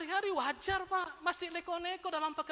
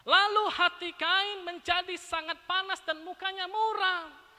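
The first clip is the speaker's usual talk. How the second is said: slow, loud, solemn verse reading; preacher reading the Bible verse aloud, slow and solemn; scripture reading; 3 semitones higher, 7 dB louder, slower than usual. Acted. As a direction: read the verse out slowly and weightily.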